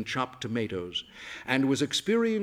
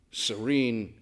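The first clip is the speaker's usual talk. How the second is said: ordinary delivery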